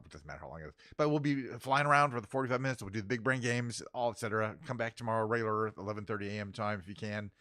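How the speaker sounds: usual speaking voice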